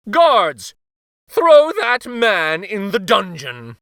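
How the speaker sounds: loud, slow stern order; royal command. A narrator's reading. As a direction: command the guards. King orders guards.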